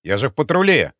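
loud, falling protest on "патруле"; indignant statement ending down on "патруле"; taken aback. A narrator's reading. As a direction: protest that you are "в патруле", caught off guard. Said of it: "опешил Мищенко".

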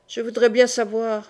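ordinary delivery, nothing marked

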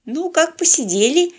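high, slow, syrupy daughter voice; unctuous sweet voice. A narrator's reading. Daughter innocently asks daddy how it went, unctuous. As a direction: draw it out with oily sweetness. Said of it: "елейным голоском осведомилась дочурка".

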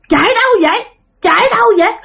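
high-pitched possessed questioning; possessed woman. Retold or imitated. Possessed woman questions the frightened mother, high and eerie. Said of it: "Mà cứ hỏi cười vậy".

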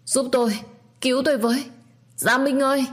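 high, loud panicked cry for help; desperate scream for help. A narrator's reading. Husband trapped, shouting for help from another room, panicked. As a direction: scream out for rescue in terror. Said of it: "bỗng có tiếng hét lớn vang vọng".

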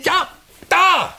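high, loud barked pointing; impatient shout. Acted. Theatre director shouting at his son during rehearsal, loud and impatient. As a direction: snap at him to look right there.

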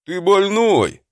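loud, high-pitched yell. Acted. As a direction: yell it at him in alarm. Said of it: "заорал на это Тормот".

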